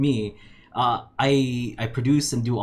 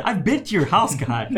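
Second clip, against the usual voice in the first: ordinary talk, nothing marked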